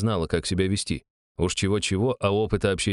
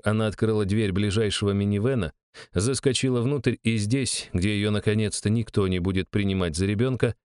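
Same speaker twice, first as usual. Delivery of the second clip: ordinary delivery, nothing marked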